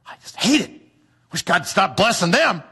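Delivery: hammed-up jealous grumble; mock resentment. Voicing envious person again.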